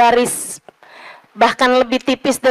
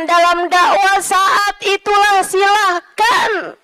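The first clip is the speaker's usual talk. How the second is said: higher, rising sermon cadence, drawn out on silahkan; preacher urging the faithful, higher and slower at each refrain; preaching build; 6 semitones higher than usual. Acted. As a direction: preach it with mounting fervor. Preaching crescendo.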